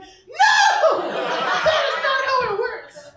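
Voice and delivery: loud, high laughing protest; laughing shout. Retold or imitated. Correcting her brother in the retelling, a loud, high, laughing shout. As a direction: shout him down, cracking up. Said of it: "And I was like".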